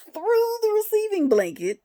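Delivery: high, loud incredulous exclamation; can't believe how far it got; loud disbelief. Real, not acted. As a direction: exclaim it in exasperated amazement. Incredulous telling of the diaper blowout.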